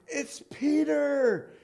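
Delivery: high, slow, thrilled announcement of Peter; excited cry. Retold or imitated. Servant girl at the door announcing Peter, excited high cry. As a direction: servant girl calling out in amazed joy.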